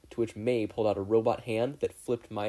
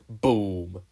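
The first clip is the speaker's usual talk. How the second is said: a louder booming explosion word; 8 dB louder than usual. A narrator's reading. Narrator voices the lab explosion, booming. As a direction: punch out the blast.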